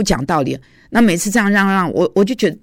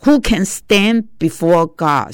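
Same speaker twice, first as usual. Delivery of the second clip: scripture quote; much slower than usual. Acted. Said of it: "就像诗篇讲的嘛".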